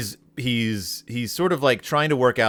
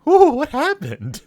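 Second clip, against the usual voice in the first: very high, slow, louder bewildered voice; mock-dazed woman; 6 dB louder than usual. Host voicing her after the creature leaves, high mock bewilderment. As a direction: play a woman coming round, exaggeratedly high. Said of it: "and she's like".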